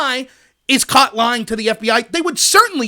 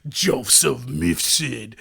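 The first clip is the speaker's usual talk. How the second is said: slow, low, ominous villain's name; mock-sinister Bond villain reveal; 11 semitones lower, 7 dB quieter, much slower than usual. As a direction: draw the name out low and soft, like a movie villain. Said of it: "he sounds like a Bond villain".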